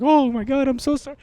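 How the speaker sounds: imitating the vet's high apology; vet's sympathy. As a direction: gush a shocked sorry like the vet. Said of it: "and then she was like".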